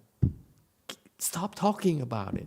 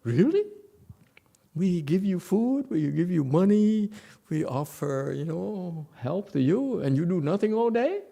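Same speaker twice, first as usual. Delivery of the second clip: mock incredulity; 6 semitones higher, 4 dB louder than usual. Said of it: "They look at you and say".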